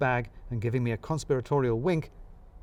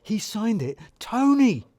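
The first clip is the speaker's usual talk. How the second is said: high, eager boss pointing something out; excited boss voice; 9 semitones higher, 6 dB louder, slower than usual. Acted. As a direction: pitch it up like an overeager manager. Balls excitedly showing the signature.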